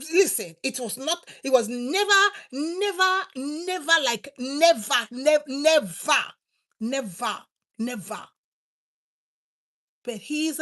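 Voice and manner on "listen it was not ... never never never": NEVER hammered again and again, then fading; repeated "never", loud at first, then trailing off; insistent repeated never. Real, not acted. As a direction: pound the word "never" over and over, then let it drop. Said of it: "repeated never".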